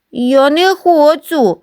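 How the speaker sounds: put-on high, slow frightened child cry. Scared boy refuses the punishment pit, high slow cry. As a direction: cry out in fear, drawn out. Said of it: "скрикнув Гриць".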